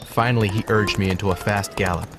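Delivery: ordinary delivery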